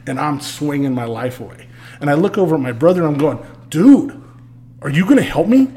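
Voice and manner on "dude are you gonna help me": raised, higher call for backup across a bar fight; yelling for help. Retold or imitated. To his brother during the bar fight, a raised yell for help. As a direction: shout over the brawl at your brother.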